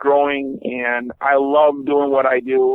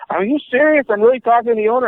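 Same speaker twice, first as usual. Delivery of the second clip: amazed caller; 8 semitones higher, faster than usual. Retold or imitated. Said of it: "And he'd be like".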